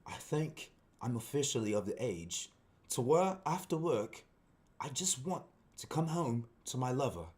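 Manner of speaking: measured, halting put-on British accent; English accent. Shown as a demonstration. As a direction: read it like a refined Englishman, pausing between phrases. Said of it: "an English accent".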